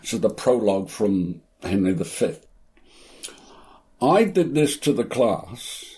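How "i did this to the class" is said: ordinary talk, nothing marked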